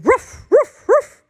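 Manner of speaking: high, loud, short ruffs; little dog barking. Acted. Grandma reads the little dogs, barking high. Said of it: "And little dogs go".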